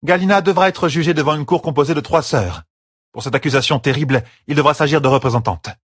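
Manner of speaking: high-pitched, formal voice of a stern sister; firm, authoritative Séonide. Acted. Séonide explains the trial to Perrine, firm and formal. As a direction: lay down the law firmly.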